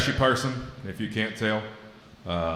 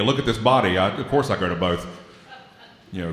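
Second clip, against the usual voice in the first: usual speaking voice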